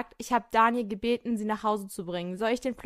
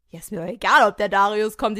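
defiant; 9 dB louder than usual. Acted. Defiant reply to Brand.

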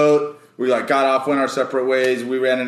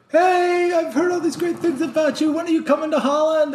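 very high, sing-song mother's voice; mock eager mom. Her mom inviting him to Holland, high gushing mom voice. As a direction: gush like an excited mum. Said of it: "her mom messaged me and was like".

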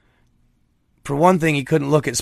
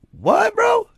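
baffled squeak. Retold or imitated. Repeating grandpa's baffled reaction.

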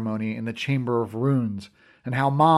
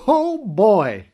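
loud and higher, no care for the sickroom; loud, not quiet; 8 semitones higher, 6 dB louder than usual. Acted. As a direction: blurt it out loud. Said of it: "with no intention of being remotely quiet".

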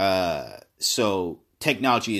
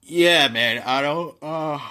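yawning; 5 semitones higher, 4 dB louder, slower than usual. Real, not acted. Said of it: "let me yawn this stretch".

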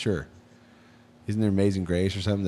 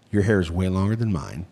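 usual speaking voice

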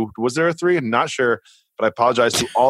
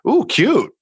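high, cooing reaction; admiring coo; 10 semitones higher than usual. Retold or imitated. Imitating a friend who glances up at a screen, admiring coo. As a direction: coo it higher than usual.

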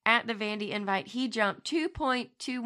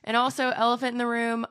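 ordinary talk

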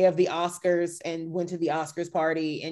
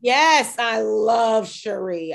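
gushing; 3 semitones higher, 5 dB louder, much slower than usual. Real, not acted. Gushing over a favorite cast member.

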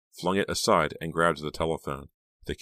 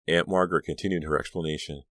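ordinary delivery

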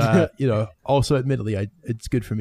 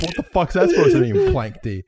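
mock outrage; 7 semitones higher, 3 dB louder than usual. Real, not acted. Mock offense at nickname.

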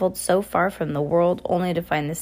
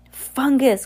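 higher, louder exclamation; cried out; 7 semitones higher, 5 dB louder than usual. A narrator's reading. Sunny exclaims at the villain's news, crying out. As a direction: call it out in surprise. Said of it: "cried Sunny".

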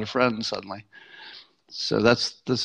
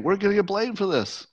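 higher-pitched, mild mock protest; retold yelling; 9 semitones higher than usual. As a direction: imitate an indignant complaint without shouting. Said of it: "So she's yelling at him".

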